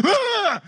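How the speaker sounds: sudden high-pitched shriek; mock scream cutting off the crooning; startled scream. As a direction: jump out of your skin. Sorceress startled mid-séance by ghost.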